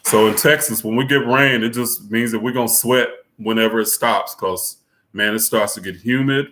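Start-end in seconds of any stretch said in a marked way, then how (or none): none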